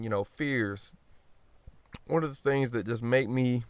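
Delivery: ordinary talk, nothing marked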